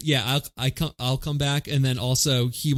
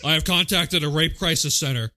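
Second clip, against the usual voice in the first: stiff robot cop announcement; mock robotic officer; RoboCop; 3 semitones higher than usual. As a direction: report it like a machine. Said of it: "you just go to RoboCop for that".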